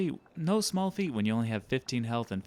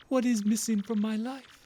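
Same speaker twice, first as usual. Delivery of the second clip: high theatrical lament; hammed-up existential wail of a merchant; anguished rich trader; 13 semitones higher, 5 dB louder than usual. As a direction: cry out grandly, pitched high.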